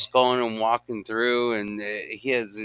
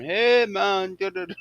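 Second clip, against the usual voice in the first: high, singsong mock Jamaican accent; 10 semitones higher than usual. Retelling the baked gorilla's line, high Jamaican accent. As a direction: imitate a high-pitched Jamaican stoner. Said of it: "a picture of him going".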